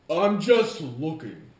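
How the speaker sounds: loud, drawn-out mock customer; customer brushing off. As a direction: imitate a customer loudly, slowly. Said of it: "And then if they say".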